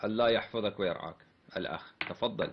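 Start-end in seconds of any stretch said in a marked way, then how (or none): none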